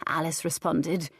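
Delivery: ordinary delivery, nothing marked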